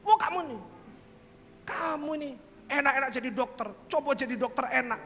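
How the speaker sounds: parodying critics who mock his choice; mocking Surabaya critic. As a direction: tease him like a scornful townsman. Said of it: "orang Surabaya ngomongnya".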